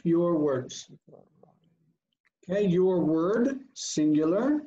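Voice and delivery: usual speaking voice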